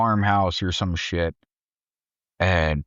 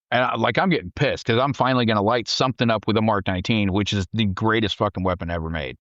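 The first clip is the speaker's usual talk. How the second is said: ordinary delivery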